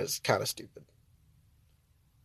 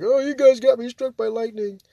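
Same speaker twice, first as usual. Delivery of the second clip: higher, whiny Karen complaint; mock Karen; 8 semitones higher, 3 dB louder than usual. Vlogger mocking a Karen blaming the store, mock whine. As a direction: sneer it like an entitled customer. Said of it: "I'm sure if it was a Karen".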